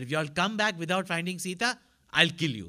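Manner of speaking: a loud retold threat, king to monkeys. King Sugriv threatening the monkeys, loud.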